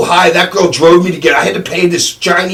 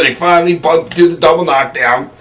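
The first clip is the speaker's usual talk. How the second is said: usual speaking voice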